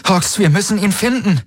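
high-pitched urging; urgent plea. A narrator's reading. Kirai urges Hox to search, high urgent plea. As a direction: press a companion to act, pitched high.

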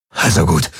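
ordinary delivery

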